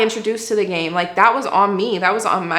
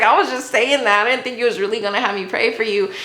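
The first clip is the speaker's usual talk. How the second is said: laughing; 4 semitones higher than usual. Real, not acted. Laughing at own reaction.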